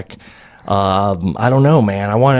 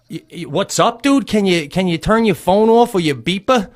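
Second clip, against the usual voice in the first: stammering, higher-pitched ask; hesitant, raised-pitch plea to a neighbor; retold timid request; 9 semitones higher than usual. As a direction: act out a nervous, stuttered request. Himself timidly asking the neighbor.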